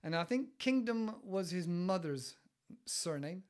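ordinary talk

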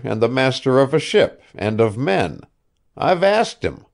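put-on an old man coaxing, talking up his pet ship; old ship owner Asa. A narrator's reading.

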